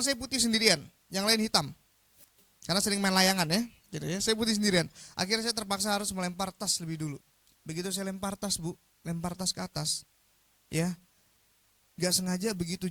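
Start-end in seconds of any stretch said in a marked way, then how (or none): none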